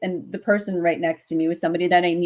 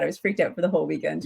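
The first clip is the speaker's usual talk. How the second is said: ordinary delivery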